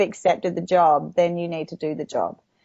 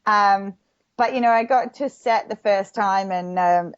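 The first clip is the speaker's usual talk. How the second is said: ordinary delivery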